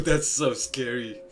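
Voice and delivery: laughing it off, slow; real laughing at being scared; nervous laugh. Real, not acted. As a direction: laugh through the fright. Laughs at spooky story.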